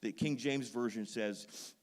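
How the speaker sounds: loud, ringing pulpit delivery; full-force preaching. Acted. Pastor fires up the congregation on Paul, preaching loud. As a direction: preach it out to the whole room.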